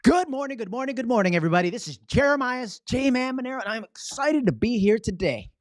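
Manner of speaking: high, loud, bursting with energy; excited. Shown as a demonstration. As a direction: greet the crowd like a hyped-up host. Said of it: "body language that demonstrates my excitement".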